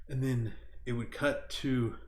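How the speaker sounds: ordinary talk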